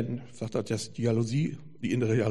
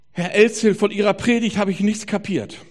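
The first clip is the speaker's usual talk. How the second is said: emphatic retold confession; 7 semitones higher, 9 dB louder than usual. Retold businessman's blunt confession.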